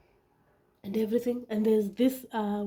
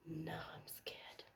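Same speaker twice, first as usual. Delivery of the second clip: slow, breathy frightened whisper; a slow retold whisper of fear; scared whisper; 6 semitones lower, 23 dB quieter, slower than usual. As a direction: barely voice it, frozen with fear.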